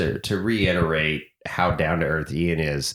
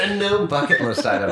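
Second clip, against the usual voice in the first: laughing echo; 9 semitones higher than usual. Real, not acted. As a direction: chuckle it back amused, up high. Echoes Rhonda's phrase laughing.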